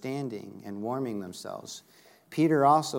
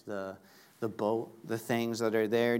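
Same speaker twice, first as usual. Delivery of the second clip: ordinary talk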